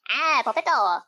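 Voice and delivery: lightly done Minion squeak; a quick cartoon Minion bit. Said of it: "I can do all the Minions for them".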